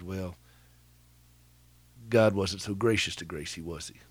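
soft, pointed and cutting; cutting remark. Retold or imitated. To the woman who spoke of God's grace, a soft cutting retort. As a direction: quietly cut her down with the words. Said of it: "that was a cutting thing to say".

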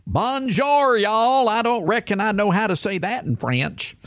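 exaggerated Southern accent imitation; mocking Southern accent. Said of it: "speaking in a stereotypical Southern accent".

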